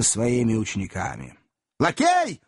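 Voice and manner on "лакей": high, sharp summons; bawling for service. A narrator's reading. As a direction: yell for the waiter. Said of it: "заорал незнакомец". (громкий оклик лакея)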